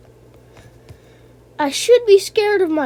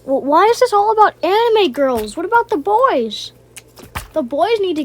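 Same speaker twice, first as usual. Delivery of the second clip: mock outrage; 6 semitones higher, 6 dB louder than usual. Real, not acted. Protesting anime girls get everything.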